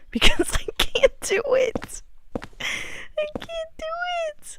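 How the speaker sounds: real voice breaking into tears; real crying. Real, not acted. Gamer stuck on the puzzle, breaking into real sobbing. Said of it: "felt like crying because of how frustrated".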